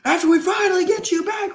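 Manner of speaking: high-pitched outraged shout; loud, hammed-up angry outburst; [name]. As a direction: crash out, yelling in disbelief. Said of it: "Reasonable crash out by [name]".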